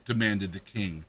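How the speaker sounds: plain reading voice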